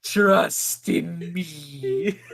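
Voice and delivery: very slow, soft, hypnotic; hammed-up slow trance voice; mock hypnotist. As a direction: lull them like a snake charmer.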